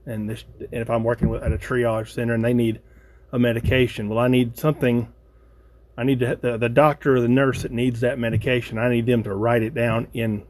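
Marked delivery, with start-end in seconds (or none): none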